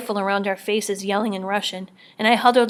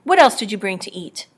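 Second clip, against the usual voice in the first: demanding; 7 dB louder than usual. A narrator's reading. Bald man demands food.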